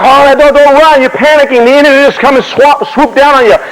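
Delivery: acted commander rallying panicked soldiers; urgent officer pleading. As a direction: beg the men not to run. Acts Washington holding back panicking men.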